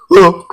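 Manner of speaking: loud, high closing "Oh" tacked on like a tic; Miguel's signature sign-off sound; Miguel's ending drawl. Shown as a demonstration. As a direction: punch out his trademark "Oh" at the end. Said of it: "Miguel tiene siempre el deje al final".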